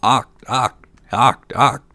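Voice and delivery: loud, evenly spaced frog cries; croaking frogs. A narrator's reading. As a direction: croak each word separately like a frog. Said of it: "the frogs maintained their opinion and cried continually".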